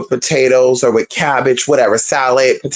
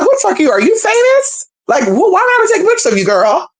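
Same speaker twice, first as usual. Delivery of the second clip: retold indignant rant. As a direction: fire off the outrage, half joking. Retold rant at a rude shopper.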